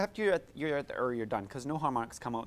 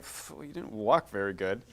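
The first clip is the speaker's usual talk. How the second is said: scoffing, lower mock put-down; mock disdain; 6 semitones lower than usual. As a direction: sneer at a toddler, jokingly. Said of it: "you're like".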